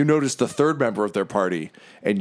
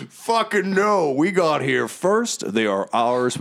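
defiant hero; 6 semitones higher, slower than usual. Acted. NPC refuses to hand rods.

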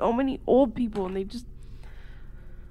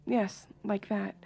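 sultry hush; 9 dB quieter, slower than usual. Pretend. Mock sultry aside.

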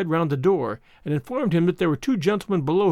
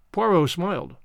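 ordinary delivery, nothing marked

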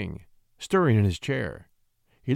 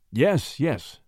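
mildly impatient, quick double assent; 3 semitones higher than usual. A narrator's reading. King cutting in on the young poet, impatiently. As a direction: brush it aside with a touch of impatience. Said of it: "said the king, impatiently".

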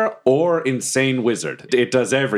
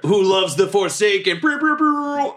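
radio love dedication; 9 semitones higher than usual. Pretend. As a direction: shout the dedication like a DJ. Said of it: "Sound like a radio D J".

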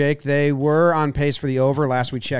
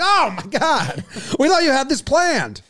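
panicked soldier; 12 semitones higher, 3 dB louder than usual. Retold or imitated. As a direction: cry out in sudden panic.

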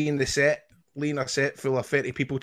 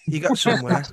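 Manchester accent; 6 dB louder than usual. Shown as a demonstration. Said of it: "What did I have to say again?".

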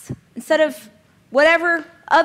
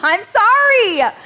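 slow, high and loud through laughter; giggling sorry; laughing apology; 8 semitones higher, 7 dB louder, much slower than usual. Real, not acted.

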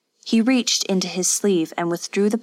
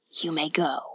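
soft whispered command from a lord; quiet, final master's order; cold dismissal; 3 semitones lower, 5 dB quieter than usual. A narrator's reading. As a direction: dismiss her in a hushed, cold voice. Yasutoki dismisses the wife.

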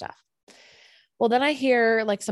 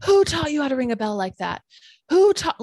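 louder, higher escalating yell; angrier yell; 6 semitones higher, 6 dB louder than usual. Retold or imitated. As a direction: build the rant until it nearly screams. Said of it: "and he's getting angrier".

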